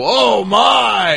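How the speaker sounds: loud, high, stunned "oh my"; mimicking a play-by-play man caught off guard, shouting; Michael Cole's shout. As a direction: blurt it like a commentator losing his mind. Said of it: "Michael Cole was just going out of his mind".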